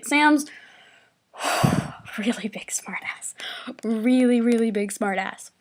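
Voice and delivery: low, hushed aside after a sigh; quiet, half-whispered exasperation; muttered aside. Real, not acted. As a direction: say it under your breath. Muttered aside about friend.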